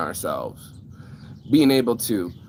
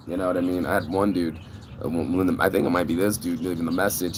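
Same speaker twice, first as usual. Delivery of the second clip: ordinary delivery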